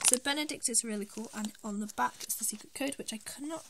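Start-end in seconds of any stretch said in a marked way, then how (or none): none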